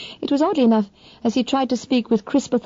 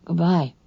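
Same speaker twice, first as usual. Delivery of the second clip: Goodbye falling away to nothing; dying fall; 2 semitones lower than usual. A narrator's reading. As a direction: let Goodbye sink, final. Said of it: "whose dying fall made it utterly final".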